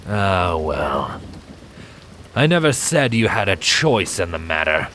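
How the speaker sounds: a touch of cold dismissal; bored villain. A narrator's reading. Silent dismissing Primrose before lunging, lightly voiced bored villain. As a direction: shrug her off lightly. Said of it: "Silent rolled his eyes".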